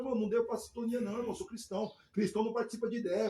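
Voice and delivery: retold quick refusal to the gang; firm refusal. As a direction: refuse them quickly and firmly.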